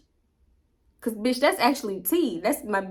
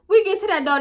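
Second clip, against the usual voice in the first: excited; 10 semitones higher, 6 dB louder than usual. Real, not acted. Building to the punchline.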